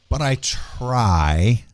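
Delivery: "try" drawn out very long and slow; stress on try. Real, not acted. Admitting own limits to the co-hosts, heavy stress on "try". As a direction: lean hard on "try", stretching it out. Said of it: "I will emphasize the word try".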